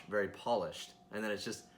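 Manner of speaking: ordinary talk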